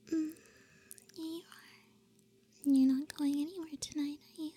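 ordinary delivery

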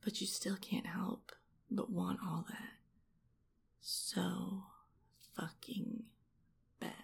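hushed, teasing whisper; seductive whisper. Acted. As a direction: whisper it, leaving space between the last words. Seductive taunt after the tirade.

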